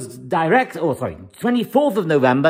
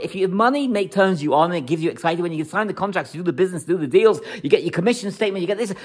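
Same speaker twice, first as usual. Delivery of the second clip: normal talking voice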